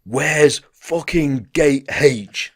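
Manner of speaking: loud, slow bellowed demand; dead loud. Retold or imitated. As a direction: bellow it slowly, each word drawn out. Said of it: "Dead loud".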